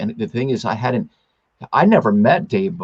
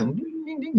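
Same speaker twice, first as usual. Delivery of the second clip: tiny high-pitched "ding, ding"; high, soft imitated chime; 10 dB quieter than usual. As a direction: squeak out quick soft dings, very high. Imitates a reaction in his story.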